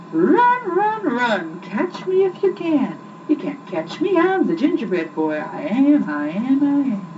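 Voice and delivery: high, cheeky taunting chant; teasing gingerbread boy. A narrator's reading. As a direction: taunt the chasers like a cocky cookie. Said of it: "laughed and called out".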